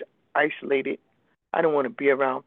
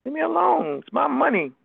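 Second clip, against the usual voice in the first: higher, defiant brush-off; defensive retort; 10 semitones higher than usual. Retold or imitated. Answering friends who talk about her money, defiant and higher. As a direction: snap back at them to back off. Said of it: "And I'm like".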